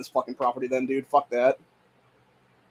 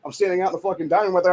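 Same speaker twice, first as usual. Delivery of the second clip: ordinary talk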